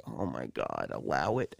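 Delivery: very low, barely audible mutter; weary, hushed grumble to yourself; exasperated aside. Retold or imitated. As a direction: mutter it under your breath in disbelief. Exasperated reaction to her request.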